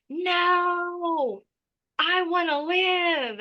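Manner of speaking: an inner voice pleading to live; soul crying out. Retold or imitated. Telling how her soul suddenly cried out, a high drawn-out plea. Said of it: "cries out from the depth of my soul".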